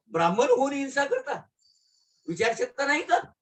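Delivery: high-pitched, incredulous questioning; mock reproach. Baba chiding the Brahmin for violence, mock reproach. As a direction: scold with rising, disbelieving questions. Baba's acted reproach of Kaka, in verse.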